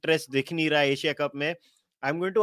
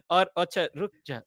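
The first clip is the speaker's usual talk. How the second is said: ordinary talk, nothing marked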